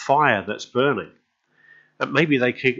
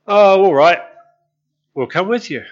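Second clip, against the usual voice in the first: higher, louder, lightly voiced resigned disciples; mild mock sigh of the disciples; reluctant agreement; 7 semitones higher, 9 dB louder than usual. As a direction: shrug it off and give in.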